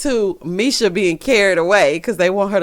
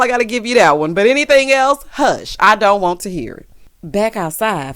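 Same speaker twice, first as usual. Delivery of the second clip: ordinary talk, nothing marked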